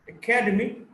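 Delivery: academy as a-CAD-emy; academy, weight on the second syllable; shifting-stress demo. Shown as a demonstration. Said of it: "stress shifts depending on the morphological structure".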